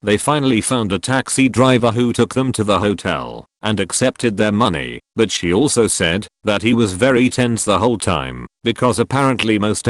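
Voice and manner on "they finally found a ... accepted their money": usual speaking voice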